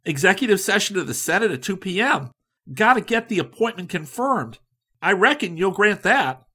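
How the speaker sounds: high, loud and hurried; no time to fool around; impatient go-getter. A narrator's reading. Likens insisting on tonight, high and hasty.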